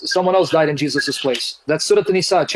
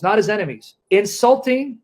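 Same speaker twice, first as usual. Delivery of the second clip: usual speaking voice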